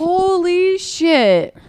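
high, drawn-out disbelief; stunned, slow exclamation; astonished. Real, not acted. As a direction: stretch it out in amazement. Astonished by co-host's lyric quote.

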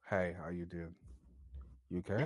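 low, slow come-on with gaps between phrases; demonstrating a slow, seductive delivery; slowed-down flirty voice. Shown as a demonstration. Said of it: "I slow it even down slower".

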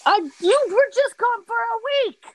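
high, loud whiny complaint; whiny protest. Pretend. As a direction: whine it like a clingy friend.

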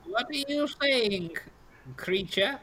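put-on high, slow old crone; old pastry seller. Old lady questions a customer, high slow crone voice. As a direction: draw it out high as an old woman.

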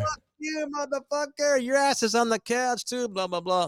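high, hammed-up shriek of a drunk wife; mock furious wife. Drunk wife screeching mock fury at husband. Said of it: "she's like".

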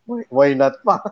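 usual speaking voice

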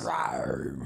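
low growling big cat roar; Panther roar. Shown as a demonstration. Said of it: "That's my Panther sound".

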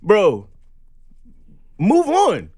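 loud, high, fed-up move on; exasperated yelling. Real, not acted. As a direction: wave off the pest, voice soaring. Exasperated at a harasser.

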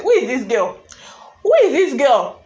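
acted disbelief. Crowd reacting to the strange speaker, loud disbelief. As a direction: demand it, incredulous and building. Astonished reaction to the girl, retold.

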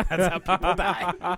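laughed-through punchline; amused, laughing as you speak. Real, not acted. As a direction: repeat it while cracking up. Repeats own joke, cracking up.

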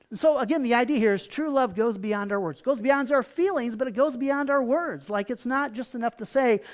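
ordinary delivery

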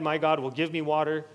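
normal talking voice

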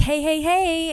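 high, loud, bubbly "hey" voice; peppy email greeting. Acted. Voicing own friendly client email.

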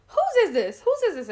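high, loud mock questioning; astonished stepmom. Stepmom asking Eric about the onesie, mock astonishment. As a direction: demand it high, imitating her astonishment.

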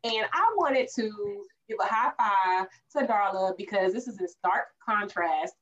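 usual speaking voice

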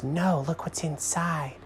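slow, high squirrel voice; coaxing squirrel. A narrator's reading. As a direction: coax them slowly, up high. Squirrel hints at secret.

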